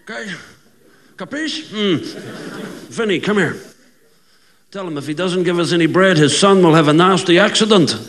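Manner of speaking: mock Italian mobster leading mass; gangster pope. Gangster pope says mass and threatens, as a hammed-up mobster. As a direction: pray like you are shaking someone down. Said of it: "they become gangsters".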